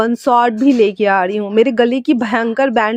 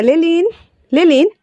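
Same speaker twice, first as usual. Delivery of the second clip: high, raised call across the house; 7 semitones higher than usual. Acted. Wing calls Lelin to the phone, calling out high. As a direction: call his name from afar.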